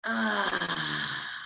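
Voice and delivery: long falling sighed "ah"; audible exhale. Shown as a demonstration. Teacher releases breath as class follows, audible sigh. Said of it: "And then exhale".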